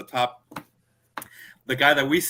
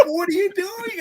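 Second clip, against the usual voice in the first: high, laughing what are you doing; laughing disbelief. Retold or imitated. Friend peeing on the church, high laughing disbelief. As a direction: laugh it out, can't believe it.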